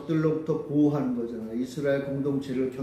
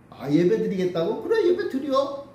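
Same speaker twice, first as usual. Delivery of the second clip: mock permission, pitched up and scoffing; 4 semitones higher than usual. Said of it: "조롱하는 거예요".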